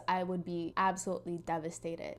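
ordinary talk

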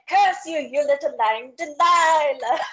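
hammed-up furious Phantom, slow and high; theatrical mock rage at Christine; mock yelling. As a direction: spit the curse like a scorned lover. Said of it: "he starts yelling at her".

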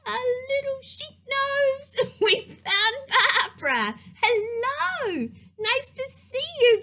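high-pitched, thrilled squeal of greeting; rising delight, high and gleeful; delighted discovery. Pretend. Storyteller finding the sheep puppet for kids, delighted.

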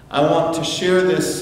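ordinary talk, nothing marked

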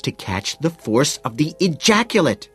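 patience shot. A narrator's reading. Woman explaining to her lover, patience shot. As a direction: voice rising high in exasperation. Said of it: "her voice rising, her patience shot".

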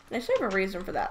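ordinary talk, nothing marked

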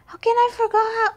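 frustrated shout. Real, not acted. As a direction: yell at yourself in frustration. Frustrated with herself.

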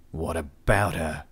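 low, slow, sharp demand; curt demand from the leader; demanding sharply. A narrator's reading. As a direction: press him for the answer, sharply. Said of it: "Adrian demanded sharply".

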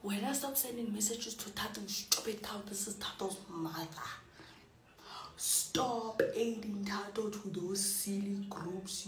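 a hint of a stern mother; Tato's mother. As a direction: scold like an annoyed mum. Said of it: "make me do Tato's mother".

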